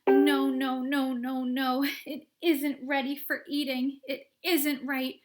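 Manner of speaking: loud, high anxious no's; frantic pleading. Acted. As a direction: beg her to stop, panicking. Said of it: "wringing his hands and saying".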